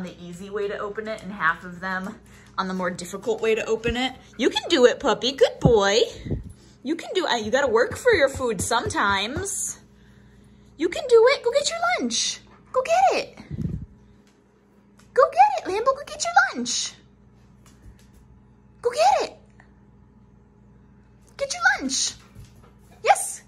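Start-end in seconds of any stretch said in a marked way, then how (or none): 4.4-11.4 bright, louder encouragement, pitch jumping up; cheering the dog on. Real, not acted. Owner cheering Lambo on at his food puzzle, excited. 11.4-23.4 very high, sing-song urging for the dog; high pet voice. Real, not acted. Owner urging Lambo to get his lunch, very high pet voice.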